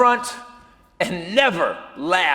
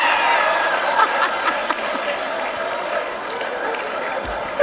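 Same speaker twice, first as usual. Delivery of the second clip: high wheezing laugh; hysterical laughter; 13 semitones higher than usual. Acted. Colleague unable to stop laughing backstage, wheezing hysterics. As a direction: dissolve into long, breathless laughter. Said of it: "he is shaking with laughter".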